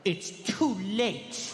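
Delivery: mock wounded kid, drawn out; hammed-up attitude. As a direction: drag out every word to guilt your mother. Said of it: "give my mom unlimited attitude".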